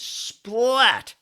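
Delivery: high, loud punch sound; loud splat. Acted. The Aussie hits the loudmouth, a loud high splat. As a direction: slam out the splat. Final blow in joke.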